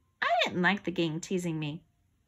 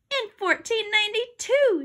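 high, raised voice; girl's shouted answer; 12 semitones higher, 5 dB louder than usual. A narrator's reading. Girl correcting her quiz rival, shouting. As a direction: call the answer out high and loud. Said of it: "shouted Andrea".

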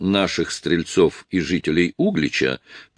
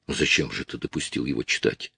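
low, soft questioning; subdued, lowered reproof; quiet reproach; 5 semitones lower, 5 dB quieter than usual. Acted. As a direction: ask it softly, pitched down. Feodosy questions Illarion.

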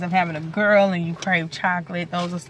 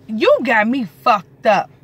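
slow, louder scolding; playful slow threat, louder; mock scold; 4 semitones higher, 9 dB louder, slower than usual. Real, not acted. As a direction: drag the words out, telling him off. Scolding husband about spider web.

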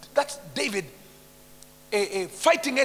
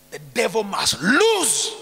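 louder, slow, each word weighted; slow forceful declaration; 9 dB louder, much slower than usual. Shown as a demonstration. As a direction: declare it slowly and firmly.

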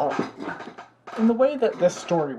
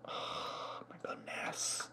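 slow drawn-out whisper; whispered dismay; 16 dB quieter, much slower than usual. Real, not acted. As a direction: breathe it out, defeated. Dismay at porch mess.

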